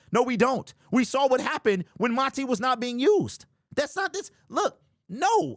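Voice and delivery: heated protest. Real, not acted. Host answering a chatter who called him emotional, worked up. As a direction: argue back hard, voice climbing.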